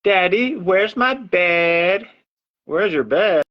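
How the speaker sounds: put-on high, slow toddler voice; little girl voice. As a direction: ask sweetly like a small child. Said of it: "Her favorite thing to ask me is".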